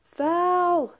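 drawn-out high call of foul. Acted. Player calling foul in the game, high drawn-out call. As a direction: sing out the call, high and held.

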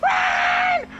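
very high panicked shriek; terrified screaming. Real, not acted. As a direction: scream a frantic warning. Monster runs at player.

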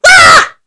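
high, loud shriek of shock; sharp scared cry; startled scream. Acted. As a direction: yelp as if something jumped out. Sudden fright.

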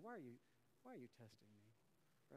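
low, hushed and unhurried; quiet calm rebuke. Shown as a demonstration. Pastor coaching church on Thanksgiving retort, hushed calm. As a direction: softly turn the question back. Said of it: "Just say that to him".

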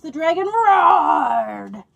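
loud, slow roaring shout; roaring narration. A narrator's reading. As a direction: roar the words like the dragon itself. Dragon's roar in the story.

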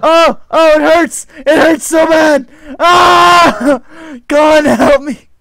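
high, loud mock pain cries; hammed-up agony. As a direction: overplay the burning pain. Character burning in game.

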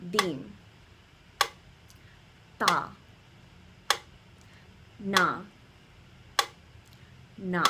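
isolated syllables, long even gaps between them; one syllable at a time to a slow beat; with the metronome. Shown as a demonstration. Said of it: "do it with the metronome".

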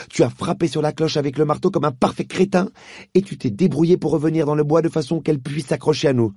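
put-on girl scolding a boy; angry girl. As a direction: tick off his blunders one by one. Polly lists his faults.

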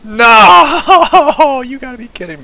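high loud wail breaking into laughter; anguished disbelief. Real, not acted. Player fails the mission again, laughing high-pitched protest. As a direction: wail in protest, laughing at your bad luck.

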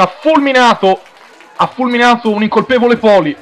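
high, loud goal call shouted breathlessly; breathless italian goal commentary. Real, not acted. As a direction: scream the goal, rising with excitement. Commentator calls a goal live.

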